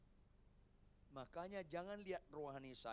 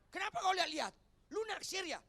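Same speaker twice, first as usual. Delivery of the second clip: high, very loud jealous shout; jealous wife yelling; 14 semitones higher, 12 dB louder, faster than usual. Acted. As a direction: scream at him for staring. Acted wife yells at husband.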